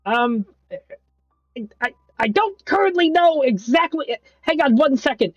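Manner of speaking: a frazzled voice crackling through a call; high pitched voice. Acted. Same NPC on the call.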